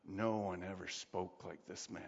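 low, soft, awestruck; awed hush. Retold or imitated. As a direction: say it in hushed wonder. Soldiers report to Sanhedrin.

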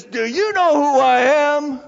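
loud, slow, high-pitched threat; threatening voice. Retold or imitated. Entitled passenger threatening the ticket clerk, loud and slow. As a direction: loom over her and demand it slowly. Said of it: "in a very threatening voice".

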